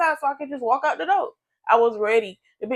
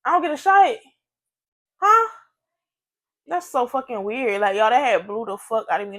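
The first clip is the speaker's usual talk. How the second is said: ordinary talk, nothing marked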